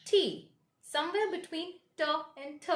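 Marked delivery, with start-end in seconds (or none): 0.0-0.5 T higher, with a puff of air; careful letter name T; aspirated T. Shown as a demonstration. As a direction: release T crisply. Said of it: "pronunciation of this letter is".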